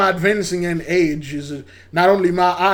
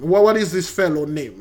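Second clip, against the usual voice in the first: ordinary talk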